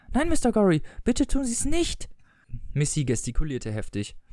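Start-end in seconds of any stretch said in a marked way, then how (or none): 0.1-2.1 high-pitched, acted plea; alarmed pleading. A narrator's reading. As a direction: beg him urgently in a raised pitch. Wife acts alarmed, pleading. (flehend, mit hoher Stimme)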